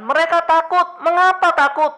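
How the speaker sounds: high, loud impassioned preaching; full-force preaching. Acted. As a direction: preach it out with urgency. Passionate sermon climax.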